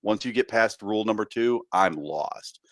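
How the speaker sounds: ordinary talk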